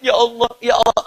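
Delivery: put-on pained plea from a sickbed; mock sick groaning. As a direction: act out a sick man moaning to God. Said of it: "begitu sakit".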